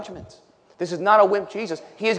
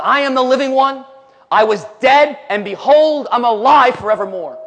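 loud, raised preaching voice; triumphant proclamation; 5 semitones higher, 5 dB louder than usual. Acted. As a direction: proclaim it as victory over death. Preaches Jesus' declaration of being alive.

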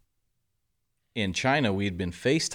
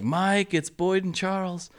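much higher, slow sing-out to another room; wife calling out; 11 semitones higher, slower than usual. Retold or imitated. Coach's wife calling him to the phone, high and drawn out. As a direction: imitate a wife calling her husband to the phone. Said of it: "she's like".

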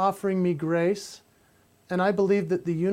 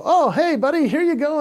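higher and louder, bright coaxing call; coaxing the dog; 8 semitones higher, 6 dB louder than usual. Retold or imitated. Man lifting pug out of the window well, higher cheery coaxing. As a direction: lift the pug up with a cheery coax. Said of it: "and I, I go".

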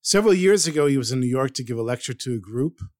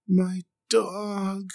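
barely getting the words out; 7 semitones higher than usual. Retold or imitated. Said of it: "he could barely get out the words".

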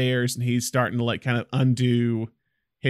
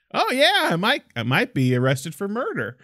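mock nonchalant; 7 semitones higher than usual. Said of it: "Tyrone Power is just so like".

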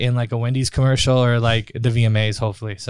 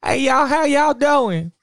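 hammed-up Southern country drawl, much higher; country accent; 10 semitones higher, slower than usual. Guest doing the country accent challenge for the podcast, hammed-up Southern twang. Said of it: "in your best country accent".

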